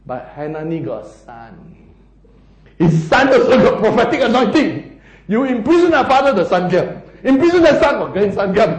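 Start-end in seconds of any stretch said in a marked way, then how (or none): 2.8-8.7 loud, excited sermon delivery; impassioned preaching. Real, not acted. Preacher on prophets succeeding imprisoned fathers, excited. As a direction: build it loud and high, line after line.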